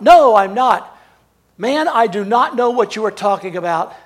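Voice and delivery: loud, heated "No, I'm not" then still firm; angry denial. Retold or imitated. Peter exploding at his accusers, loud angry denial. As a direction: let it rip at them, swearing it off. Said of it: "He's lets it rip".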